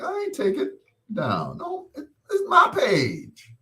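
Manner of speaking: high squeaky mock protest; indignant refusal. Retold refusal to take post down.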